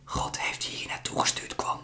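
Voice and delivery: breathy, hushed and gentle; a mother whispering comfort to the boy; soft whisper. Acted. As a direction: tell him softly it was meant to be. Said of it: "zegt ze zacht".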